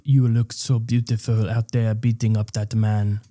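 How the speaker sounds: put-on thick French accent. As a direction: do a Frenchman speaking English. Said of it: "It's a really good French accent".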